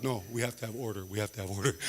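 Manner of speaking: low, quiet, stiff voice insisting on order; stern stickler. Retold or imitated. Juan Pablo insisting on order to his friends, low and stiff. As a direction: lay down the rules like a humourless chairman. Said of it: "Juan Pablo's like".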